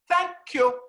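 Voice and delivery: high, loud mock grand thank-you; a star's icy, very high farewell; haughty exit. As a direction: sing it out as an imitated diva sweeping off. Betty Davis storms out.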